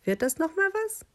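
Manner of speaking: very high, sharp sheep voice; pointed, piercing voice. A narrator's reading. Sheep prods the silent stranger, in a high pointed voice. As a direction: climb high, impatient little sheep. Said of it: "rief Schaf mit spitzer Stimme".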